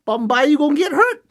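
high, teasing repeat of "Bambai, you gonna get hurt"; repeated taunt. Acted. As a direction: throw the warning again, playfully. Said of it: "that's Hawaiian slang".

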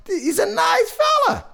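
very high-pitched, strained insistence; over-insistent squeal. Acted. Storyteller insists to children about the father, over-insistent squeal. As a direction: shoot way up high, protesting he is nice.

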